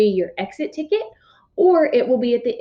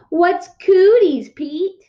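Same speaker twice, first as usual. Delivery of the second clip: put-on high, loud little-boy whine; pestering little kid; 9 semitones higher, 6 dB louder, much slower than usual. Little brother pestering big brother, high whiny kid voice. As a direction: tug at him and whine the question.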